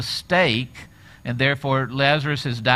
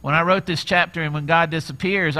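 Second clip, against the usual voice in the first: usual speaking voice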